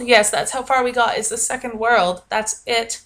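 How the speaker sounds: ordinary delivery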